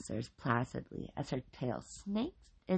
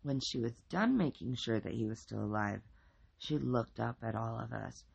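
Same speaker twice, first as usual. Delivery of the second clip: plain reading voice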